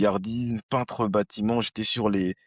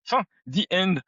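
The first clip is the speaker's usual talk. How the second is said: much higher-pitched mock wrap-up; mock finale; 8 semitones higher than usual. Speaker mocks his younger self thinking life is finished, high mock finale. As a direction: call the end in a high, playful voice.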